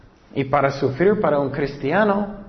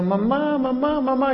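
crying out for mom; 10 semitones higher than usual. Retold or imitated. Girl at her mother's funeral calling Mamá, a high mock wail. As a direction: echo a grieving girl calling Mamá, pitched up but kept mild.

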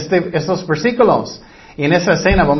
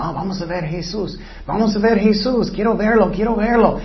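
put-on higher-pitched, eager kids chattering; excited children; 5 semitones higher than usual. Children urging each other to go see Jesus, excited. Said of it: "Ellos son muy animados".

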